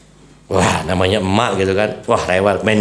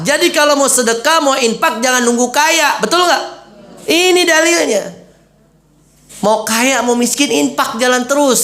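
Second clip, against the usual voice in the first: fired-up preaching; 11 semitones higher than usual. Real, not acted. Preacher urging the audience.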